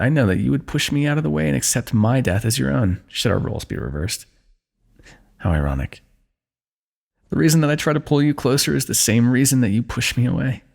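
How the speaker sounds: normal talking voice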